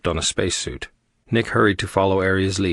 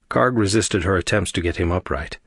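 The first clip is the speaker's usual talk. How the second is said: plain reading voice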